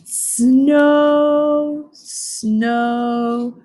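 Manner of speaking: drowsy drawn-out bear, drifting off; sleepy mumble. Acted. Bear drifting into his dreams, slow sleepy mumble. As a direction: let it fade like falling asleep. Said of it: "mumbled Bear".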